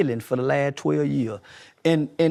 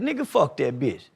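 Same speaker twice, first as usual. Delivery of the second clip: street talk demo; 6 semitones lower than usual. Shown as a demonstration. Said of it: "that I talk to kids like that".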